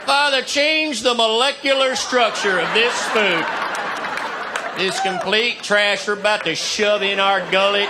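building from earnest plea to shouting; fervent prayer to shouting. Acted.

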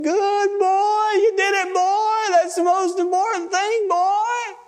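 very high, tearful grandpa voice; acted sobbing with joy; imitated crying. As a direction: weep the praise out in a high wail. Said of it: "crying and saying".

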